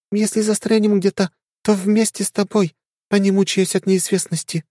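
put-on high, earnest young woman. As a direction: explain it with conviction. Ariel explains.

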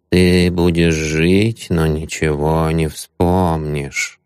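slow droning hypnotist chant; monotone hypnotist voice. A narrator's reading. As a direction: drone it out, lulling him to sleep. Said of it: "монотонным голосом стал бубнить".

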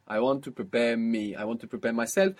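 ordinary talk, nothing marked

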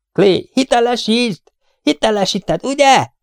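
high, eager calling out; excited high voice, peaking on the final question; excited urging. A narrator's reading. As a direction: call to a crewmate, pleading for confirmation. Luke begs Clay to confirm kill.

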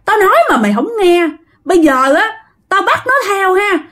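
high-pitched, indignant threats; acted outrage of a vengeful spirit; threatening spirit. A narrator's reading. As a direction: pitch it high and menace the family. Enraged spirit threatens the family.

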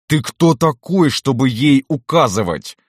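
high, indignant challenge, slow on the opening; flaring fury. A narrator's reading. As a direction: flare up and challenge him. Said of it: "Вспыхиваю в ярости". (вспыхнув от ярости, с вызовом)

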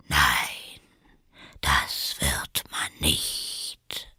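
low, slow whispery drawl; sleepy caterpillar. A narrator's reading. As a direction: contradict drowsily, half whispered. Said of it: "mit schläfriger, langsamer Stimme". (tief, langsam und schläfrig gehaucht)

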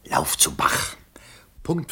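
hushed, breathless order; secretive whispered command; urgent whisper. Acted. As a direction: whisper it so no one hears.

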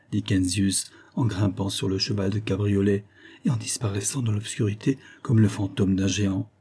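usual speaking voice

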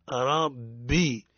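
ربي said slowly, ب held double and light; correct rabbi. Shown as a demonstration. Teacher modeling ربي for students, ب light and doubled. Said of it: "الصواب ربي مرقق الباء ومشددة".

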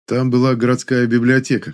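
plain reading voice

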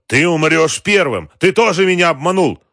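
high, loud accusation; furious threat. A narrator's reading. Boss aiming at his adviser, furious threat. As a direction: threaten at full voice, enraged. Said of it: "прохрипел".

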